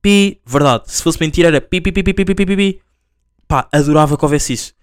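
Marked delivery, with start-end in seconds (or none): 0.0-2.8 imitated beeping.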